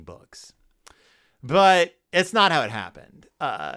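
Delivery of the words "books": whispered afterthought. Real, not acted. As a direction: whisper the last word. Unvoiced trailing word.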